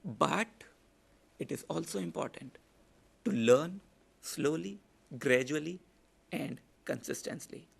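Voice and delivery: slow deliberate emphasis. Shown as a demonstration. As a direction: let each word land slowly. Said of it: "I talked slow".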